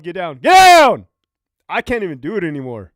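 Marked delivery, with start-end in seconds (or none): 0.4-1.1 a full-force yell, much higher and louder; shouted command. Retold or imitated. Officer ordering inmates to the ground, yelled command. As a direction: bark the order like a prison officer. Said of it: "I almost proned out when you yelled".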